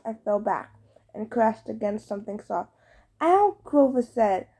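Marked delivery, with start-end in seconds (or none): 3.2-3.6 sharp high yelp of pain. A narrator's reading. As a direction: yelp when knocked down. Grover knocked over.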